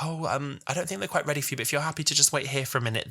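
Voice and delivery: soft, tentative, kindly explanation; sweet helpful staffer. Retold or imitated. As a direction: imitate a polite, gentle usher. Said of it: "there was a really sweet guy there who was like".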